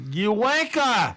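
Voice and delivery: drawn-out, high mock-British jeer; mock British accent. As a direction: exaggerate a bad British accent, stretching it out. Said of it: "Terrible accents".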